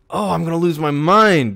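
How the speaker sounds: fed up to the edge; exasperated. Real, not acted. Exasperated after loss.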